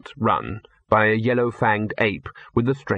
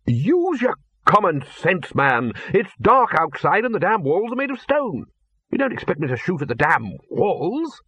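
put-on blustering old wizard; 7 semitones higher than usual. A narrator's reading. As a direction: bluster at a fool. Arch-Chancellor blustering.

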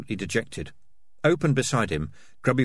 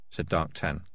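usual speaking voice

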